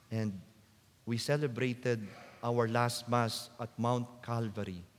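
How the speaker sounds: normal talking voice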